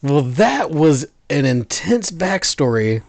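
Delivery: loud and slow, booming out; belting the reaction at full volume; really loud. Real, not acted. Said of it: "That was probably really loud".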